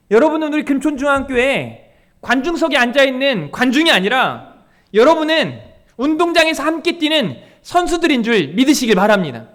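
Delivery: loud, rising sermon exhortation; preaching. Acted. Pastor urging the congregation, preaching at full force.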